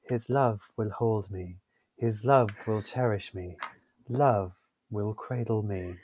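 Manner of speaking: a soft, badly faked southern Irish accent. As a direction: try for the south of Ireland, half-hearted. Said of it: "I'm gonna go to Southern accent now".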